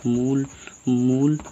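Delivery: मूल repeated with rising loudness; emphatic repeat. Shown as a demonstration. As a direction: punch मूल twice, the second harder. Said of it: "मूल स्वर".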